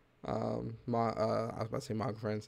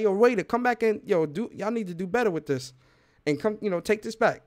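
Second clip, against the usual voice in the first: loud restaurant complaint; 7 semitones higher, 5 dB louder than usual. Retold or imitated. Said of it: "Or you gonna be like".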